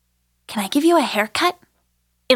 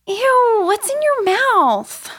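disgusted; 8 semitones higher, much slower than usual. Acted. Disgust at sticky doll.

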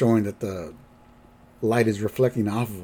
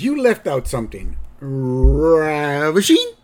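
flirty grandpa; 4 semitones higher, 4 dB louder, slower than usual. Retold or imitated. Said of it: "so Grandpa says".

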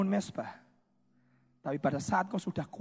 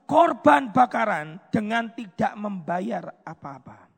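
loud, ringing scripture reading; preaching scripture; 4 semitones higher, 6 dB louder than usual. Acted. Preacher reading the king's words to the church, loud and ringing. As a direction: proclaim the verse to the congregation. Reads scripture aloud at full force.

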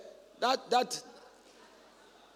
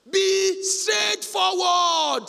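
very slow, high, loud command; drawn-out exhortation; 10 semitones higher, 7 dB louder, much slower than usual. Acted. Preacher exhorting the sisters, slow loud command. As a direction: stretch every word as a charge.

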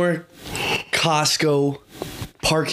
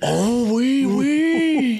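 high hammed-up French oui oui; playful high French exclamation; mock French; 8 semitones higher, much slower than usual. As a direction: toss off a silly fake French accent. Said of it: "French me".

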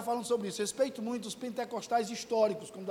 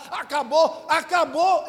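louder, higher cry of giving up; despairing cry; 4 semitones higher, 8 dB louder, slower than usual. Retold or imitated. Pastor voicing his despair at the church, louder. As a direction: throw it away like it's all over. Despairing climax of retold thoughts.

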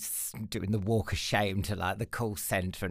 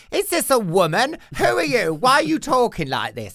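a much higher, louder voice for a baffled caller on the phone; puzzled caller; 12 semitones higher, 8 dB louder than usual. Retold or imitated. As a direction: play the confused caller asking who's on the line. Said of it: "people would ring up and go".

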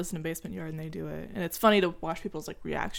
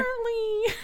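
breaking into laughter; giggling through the word; laughing; 6 dB quieter than usual. Real, not acted. Laughing at bit.

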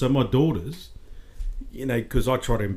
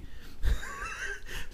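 big laugh; 8 dB quieter than usual. Real, not acted. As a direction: let a high laugh burst out. Bursts out laughing at own joke.

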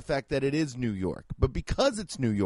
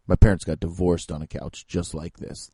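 mopey designer; 11 semitones lower than usual. Retold or imitated. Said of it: "They were like".

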